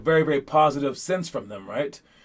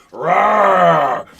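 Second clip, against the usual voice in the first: a loud hammed-up monster roar; mock dragon roar; 7 dB louder than usual. Coach mocking a dragon roar for players, loud mock roar. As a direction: roar like a fake CGI dragon. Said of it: "he was going".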